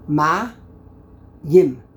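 "Ma-yim" in two loud, separate beats; teacher counting out "Ma-yim": MA, pause, YIM; syllable by syllable. Shown as a demonstration. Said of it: "has two syllables".

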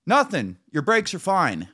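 "Your brakes are fine" said high and loud as the mocked mechanic. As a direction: brush him off like a bored mechanic. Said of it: "And they go".

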